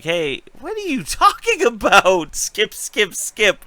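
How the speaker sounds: high, laughing put-on voice; mock character voice. Recapping Benjen's lines to a co-host in a high, laughing mock voice. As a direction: pitch it way up, half laughing through it. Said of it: "he's like".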